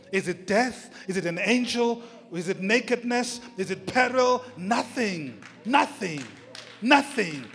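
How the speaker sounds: questions and answers mounting in pitch and force; building preaching litany. Acted. Preacher tells believers nothing separates them from love, rising preaching. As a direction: drive each repeated denial home.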